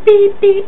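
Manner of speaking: very high, loud electronic beeps; high piercing beep-beep mimic; microwave beep imitation. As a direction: beep like a microwave that's done.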